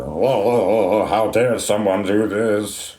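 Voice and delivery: lightly voiced slow mock gasp of outrage; hammed-up pearl clutching, slow. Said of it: "super sad and somber ... outraged".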